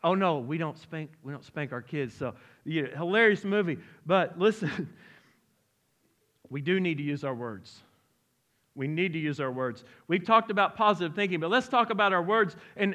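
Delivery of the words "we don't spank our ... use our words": ordinary talk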